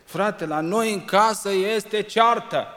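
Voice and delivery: loud, slow, high, quarrelsome cry; a family row shouted out; mock quarrel shout. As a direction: shout it like a fight at home. Quarrelling household quoted.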